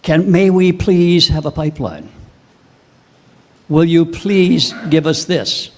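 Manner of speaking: slow, begging plea on bended knee; mocking a groveling premier; mock pleading. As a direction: plead like a supplicant, drawn out. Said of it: "gets on one knee, kisses the ring".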